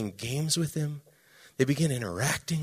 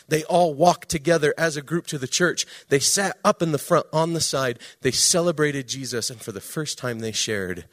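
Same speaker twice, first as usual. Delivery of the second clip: usual speaking voice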